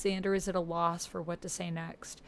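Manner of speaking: ordinary talk, nothing marked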